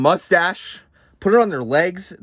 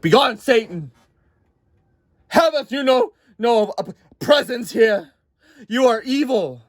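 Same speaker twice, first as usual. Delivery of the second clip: hammed-up God; 7 semitones higher than usual. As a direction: cast Satan out like a pompous deity. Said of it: "God's like".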